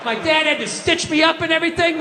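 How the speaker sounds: normal talking voice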